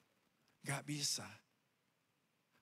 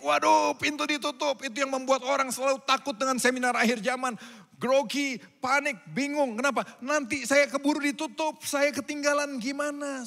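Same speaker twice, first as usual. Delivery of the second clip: higher, louder hammed-up panic; mock panic; 7 semitones higher, 9 dB louder than usual. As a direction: act flustered and scared of being left. Acting out panic at closed door. (panik yang dibuat-buat, lebih tinggi dan keras)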